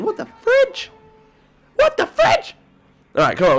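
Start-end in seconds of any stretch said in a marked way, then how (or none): 0.0-0.9 very high, rising squeak on fridge; startled squeak. Real, not acted. Startled by something in game. 1.8-2.5 very high, punch on what; high shocked yelp. Real, not acted. Repeats the startled exclamation.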